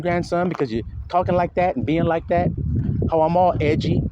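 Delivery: usual speaking voice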